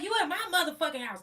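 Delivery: high, loud mock yell; yelling neighbor. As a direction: shriek at the cop.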